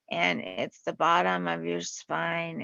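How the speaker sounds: normal talking voice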